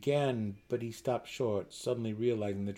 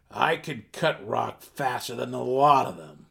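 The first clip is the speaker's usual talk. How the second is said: loud, fierce boast through a growl; 3 semitones higher, 9 dB louder than usual. Acted. Burrow Warden boasting to Driz, loud fierce growl. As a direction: bang the table and growl it out. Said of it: "Burrow Warden growled fiercely".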